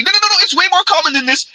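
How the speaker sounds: high, fast mock protest; mocking critics. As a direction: squeal it like a whiny critic brushing you off.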